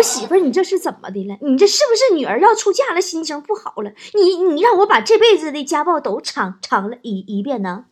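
high, loud opening that tails off stammering; mock baffled, henpecked complaint; bewildered protest. Dazed husband asks his wife what is wrong, bewildered protest.

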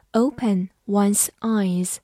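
slow, clear model phrase; slow repeat. Shown as a demonstration. Teacher repeating a phrase slowly for learners. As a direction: give it slowly for the class. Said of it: "teacher repeats slowly".

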